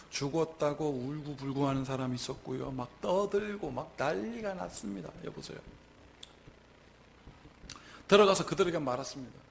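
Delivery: usual speaking voice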